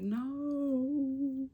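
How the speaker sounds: high squealed refusal; sharp high no. Retold or imitated. To Rob pushing the baby's head, a high squealed no. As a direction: yelp no at him mid-push.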